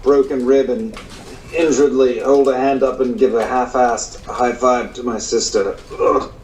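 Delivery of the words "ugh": normal talking voice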